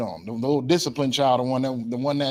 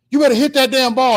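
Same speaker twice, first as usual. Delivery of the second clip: high, loud mock yell from the stands; yelling parent; 10 semitones higher, 7 dB louder than usual. Parent at baseball game to the child, yelling. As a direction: holler at your kid like an impatient parent. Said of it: "they heard you yelling at 'em".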